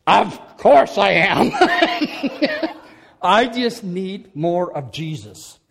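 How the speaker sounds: loud, slow, high drawn-out cry; stretched, over-the-top mock bawl; hammed-up wail. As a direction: imitate an emotional woman crying out her answer. Hammed-up retelling of woman's answer.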